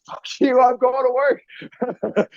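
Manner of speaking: high-pitched, drawn-out defiant outburst; defiant retort. Retold or imitated. Retells defying the hospital staff.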